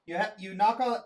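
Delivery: ordinary talk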